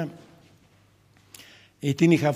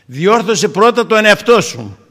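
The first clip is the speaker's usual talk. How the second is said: slow, loud, higher, weighty command; preacher commanding the congregation to correct themselves first; emphatic admonition; 7 semitones higher, 11 dB louder, slower than usual. Acted. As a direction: hammer each word home.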